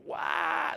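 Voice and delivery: sharp high mock yelp of pain; a hammed-up startled cry. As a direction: squeal like something hurt. Girlfriend's pained yelp.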